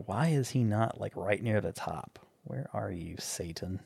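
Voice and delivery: very soft, fading into a whisper; muttering to self. Real, not acted. Co-host searching IMDb for an actor, muttering to self. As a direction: trail down to a whisper while searching.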